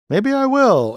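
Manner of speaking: high, teasing voice for the character; coy cartoon reply. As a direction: tease it back up in a high voice. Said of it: "And he goes".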